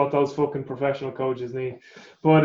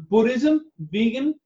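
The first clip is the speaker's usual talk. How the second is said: broken English, higher; 7 semitones higher, slower than usual. Retold or imitated. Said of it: "In his broken English".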